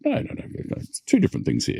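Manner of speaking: high, whispered insistent no no no; mock protest. Pushing back on the supplier's no, hushed and high. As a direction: wave off the refusal in a hushed high voice.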